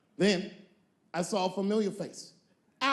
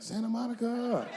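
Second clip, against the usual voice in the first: imitating O.J; 7 semitones higher than usual.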